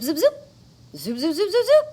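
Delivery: usual speaking voice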